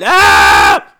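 loud hammed-up scream; mock scream. Mother reacts to the severed head, mock scream. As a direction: shriek in comic horror.

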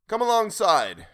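high, loud, drawn-out call; exclaiming. A narrator's reading. Will calling the stranger's boat in, exclaiming. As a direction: call the boat in slowly and loudly. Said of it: "exclaimed Will".

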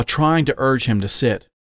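usual speaking voice

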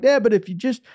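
high-pitched pleading to a parent; pleading kid. Retold or imitated. Kid begging dad for a fishing trip, pleading. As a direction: wheedle dad like an eager kid.

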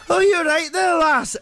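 high, jolly Scottish voice; chirpy Scots brogue, unbothered; Scottish accent. Retold or imitated. Scottish guy voiced in story.

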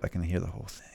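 whispered. Real, not acted. Excited aside about album.

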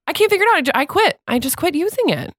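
high-pitched, fed-up complaint; exasperated. Real, not acted. Woman quitting an app she can't work, high exasperated rant. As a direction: give up on it in frustration.

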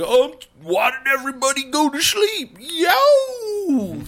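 very high mock holler into a howl; obnoxious yelling friend. Friend waking the sleeping camp, mock hollering. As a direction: whine it high, then howl the "yo". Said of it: "my other friend was like".

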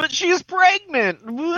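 very high, drawn-out exclamation; mock excitement. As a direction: squeal it out in a silly high voice. Mock-excited interjection about pregnancy.

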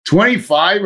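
Twenty-five? pitched high with a disbelieving rise; can't believe the increment went down again; high, rising Twenty-five? in amused disbelief; incredulous. Retold or imitated. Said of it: "Are you kidding me?".

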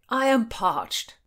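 high, slow whine; plaintive, stretched-out moan; whining. A narrator's reading. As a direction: draw it out in loud complaint. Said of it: "whined William".

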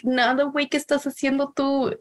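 a second little cat answering back; other cat voice. Retold or imitated. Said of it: "the other cat would be like".

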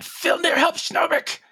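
high, urgent yell for help; yelled plea. Acted. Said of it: "I'm gonna yell in my mind".

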